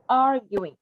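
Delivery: "arguing" with the first syllable stressed. Shown as a demonstration. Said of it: "in the first one".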